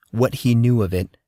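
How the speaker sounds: ordinary delivery, nothing marked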